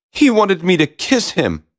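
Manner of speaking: high-pitched princess protest; high, indignant storybook princess; complaining princess. A narrator's reading. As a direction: object in disgust as a princess.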